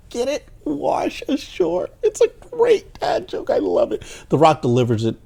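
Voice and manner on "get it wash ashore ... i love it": very high, squeaky delight; gleeful joke relish. Real, not acted. Reviewer explaining The Rock's dad joke to viewers, gleeful. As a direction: savor the pun in a high squeal, drawing it out.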